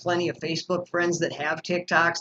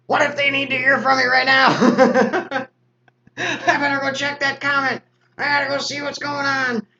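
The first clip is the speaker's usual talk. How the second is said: anxious inner voice; 9 semitones higher, 6 dB louder than usual. Retold or imitated. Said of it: "and I'm like".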